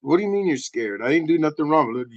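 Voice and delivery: ordinary talk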